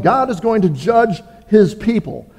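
ordinary talk, nothing marked

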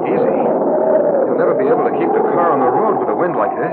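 loud warning pitched over the storm; shouting over wind. Acted. Brother warns Denton over the roaring storm, shouting. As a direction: call it out against a roaring gale.

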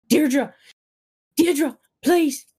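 high, loud, desperate pleading; frantic father. Acted. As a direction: cry out in panic to rouse an unconscious child. Father begging unconscious daughter to wake.

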